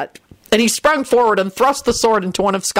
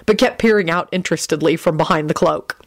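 usual speaking voice

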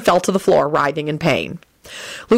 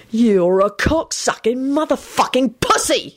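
slow, high insult hurled out; high-pitched, drawn-out angry yell; angry shout; 7 semitones higher, slower than usual. A narrator's reading. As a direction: draw out each word in a raised, angry voice. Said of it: "he shouted".

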